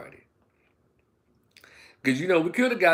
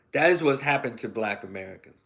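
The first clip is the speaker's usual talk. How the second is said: ordinary talk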